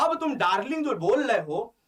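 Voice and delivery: usual speaking voice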